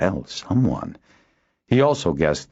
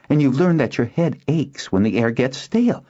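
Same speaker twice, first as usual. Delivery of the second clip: high-pitched, triumphant point-scoring; lightly crowing, pitched up; 10 semitones higher than usual. A narrator's reading. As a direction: prove your point with glee. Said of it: "Nuella added triumphantly".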